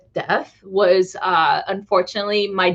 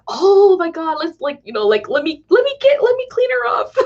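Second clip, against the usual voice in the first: aunt fussing; 9 semitones higher than usual. Retold or imitated. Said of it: "she's just like".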